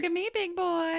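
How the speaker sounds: high, loud mock flirting; hammed-up teasing call; teasing come-on. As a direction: taunt him playfully. Co-host joins in the baiting.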